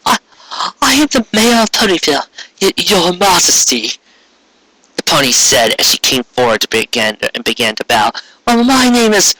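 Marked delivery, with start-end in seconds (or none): 0.1-3.9 high, halting voice near tears; frightened stammer. Acted. Mayor greeting the terrifying queen, stammering in fright. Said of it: "fighting back tears of fright". 8.5-9.3 higher boastful unicorn, cutting in; Trixie's voice. Acted. Unicorn interrupting the mayor before the queen, urgent.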